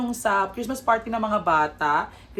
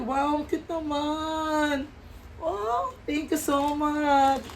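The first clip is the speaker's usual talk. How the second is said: gushing thanks; 8 semitones higher, much slower than usual. Real, not acted. Thanks student for towel.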